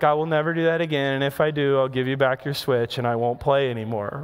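low, subdued retold apology; mock repentance. Dad to his eight-year-old after cheating, comically repentant. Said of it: "find myself repenting to my eight-year-old".

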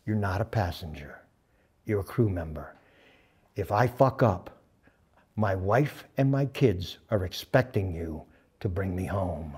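low, soft and deliberate; quiet, grave charge. Retold or imitated. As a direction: lay the responsibility on him gently. Bud's sober charge to crew member.

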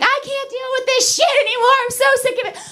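high, whiny mock boyfriend; fed-up boyfriend. Boyfriend at the end of his rope in a fight, high whiny mock. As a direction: squeal it like he's at his limit.

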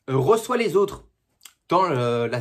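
ordinary talk, nothing marked

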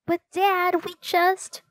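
high-pitched plea to a parent; child's protest. A narrator's reading. Daughter objects when her father calls bedtime, pleading protest.

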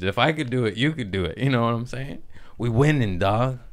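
normal talking voice

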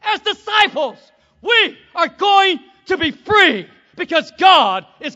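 high, loud, slow proclamation of freedom; booming, high preacher's cry; preaching shout. Retold or imitated. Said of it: "He's saying".

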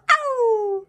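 piping pretend yelp; squeaky mock ow. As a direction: voice the dog yelping for fun.

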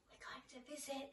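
hushed suspense whisper. Acted. Teacher building suspense for children, whispered. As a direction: whisper it like a secret.

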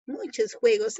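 real hoarse, voice dropping out; words with barely any voice left; voice gone hoarse. Real, not acted. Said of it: "me he quedado ronca".

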